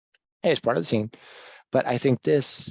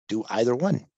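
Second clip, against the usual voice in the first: ordinary delivery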